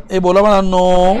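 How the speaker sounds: low, stretched-out classroom chant; drawn-out prompting call. Real, not acted. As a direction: drag out the last word on a low note. Teacher prods class to answer aloud.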